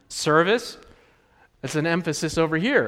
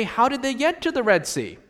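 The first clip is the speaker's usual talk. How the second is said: mock challenger; 7 semitones higher than usual. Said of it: "the contrarian to that will say".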